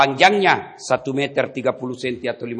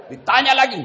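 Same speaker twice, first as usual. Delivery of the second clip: loud build-up; 11 semitones higher, 5 dB louder than usual. Acted. Preacher builds to angel's question.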